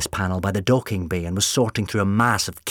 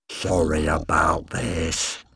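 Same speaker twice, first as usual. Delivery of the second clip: low menacing villain; 4 semitones lower, much slower than usual. Acted.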